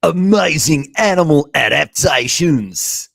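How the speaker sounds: loud, slow, high Australian accent; Steve Irwin. Shown as a demonstration. Presenter recording the title voiceover in an Australian accent, Steve Irwin. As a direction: belt it out as an Australian wildlife host. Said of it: "Or like a Steve Irwin voice".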